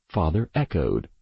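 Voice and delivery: ordinary delivery, nothing marked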